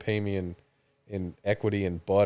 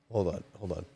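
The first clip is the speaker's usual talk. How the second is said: ordinary delivery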